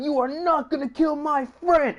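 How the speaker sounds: high, defiant hero voice; heroic Terra. Acted. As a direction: jump in to protect your friend. Terra bursts in to save Ventus.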